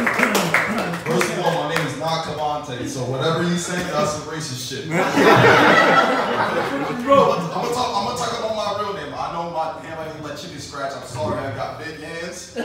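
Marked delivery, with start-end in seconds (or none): 5.0-7.5 loud high burst of laughter. Real, not acted. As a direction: crack up loudly at the joke. Laughs at own joke.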